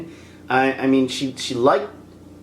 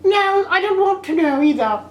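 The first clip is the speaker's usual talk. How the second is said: high-pitched mock huff; mock disgruntled girlfriend; 14 semitones higher than usual. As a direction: mimic a sulky girlfriend in a squeaky voice. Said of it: "almost like a disgruntled girlfriend".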